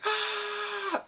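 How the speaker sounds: high, drawn-out mock shriek; mock scared scream. Viewer retelling the Grim Reaper scare, high mock scream. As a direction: squeal as if creeped out, held long. Mimics being creeped out.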